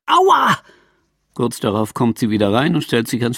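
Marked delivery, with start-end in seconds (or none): 0.1-0.6 high-pitched yelp of "ow"; pained cry. Acted. Woman kicks the umbrella stand and yelps, a high pained cry. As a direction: cry out in sudden pain, pitched way up. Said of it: "gibt dem Schirmständer einen Tritt und schreit".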